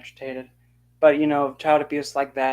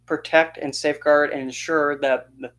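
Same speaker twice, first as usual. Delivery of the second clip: ordinary talk, nothing marked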